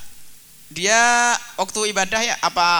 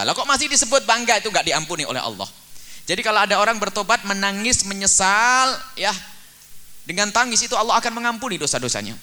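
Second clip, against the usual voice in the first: high, loud sermon climax; fiery preaching; 8 semitones higher, 5 dB louder than usual. Acted. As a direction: preach it hard to the congregation. Preaching at full force.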